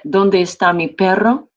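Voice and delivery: "¿Dónde está mi perro?" said as a Spanish question, "Dónde" rising. Shown as a demonstration. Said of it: "you would distinguish between that".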